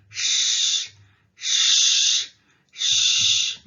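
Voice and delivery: "sh" as a long voiceless hush; consonant demonstration. Shown as a demonstration. Teacher shows learners an interesting sound, sustaining "sh". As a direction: hold each "sh" out as pure air, no vowel. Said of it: "very interesting sound".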